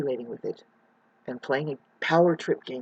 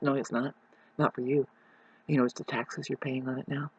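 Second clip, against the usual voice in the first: ordinary talk, nothing marked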